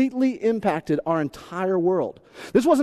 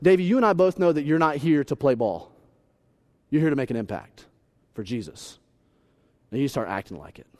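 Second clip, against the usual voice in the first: earnest coach; 5 semitones lower than usual. Retold or imitated. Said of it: "he goes".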